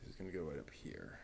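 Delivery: whisper. Real, not acted. Muttering while placing a part.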